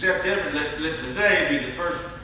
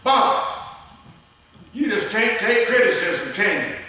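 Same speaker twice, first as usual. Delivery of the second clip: mock wifely scolding; 5 semitones higher, 4 dB louder, slower than usual. Wife scolding him, acted quote.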